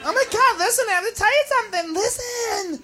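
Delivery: high, soft, insistent; acted tipsy secret-telling; confiding drunk girl. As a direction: urgently confide in your girlfriends.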